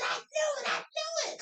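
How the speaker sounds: high, triumphant squeal; excited I knew it. Retold or imitated. Woman on the tour hearing about the Illuminati, high excited squeal. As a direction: burst out like you solved the mystery.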